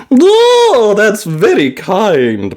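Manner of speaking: lightly voiced grandmother, wondering; a hint of a curious old lady; Granny Pig voice. A narrator's reading.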